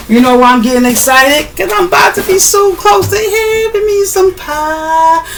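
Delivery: high, slow, sing-song glee; excited. Real, not acted. Vlogger about to have pie, high excited sing-song. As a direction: stretch it out like a happy chant.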